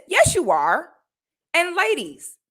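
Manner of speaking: normal talking voice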